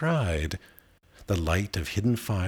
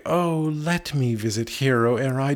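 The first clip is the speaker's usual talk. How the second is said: aghast cry; 6 semitones higher than usual. A narrator's reading. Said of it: "aghast the poor soul gan to cry".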